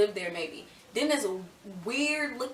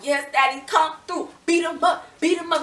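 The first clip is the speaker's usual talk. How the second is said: excited cheering; 7 semitones higher, 5 dB louder than usual. Retold or imitated. Cheering dad on.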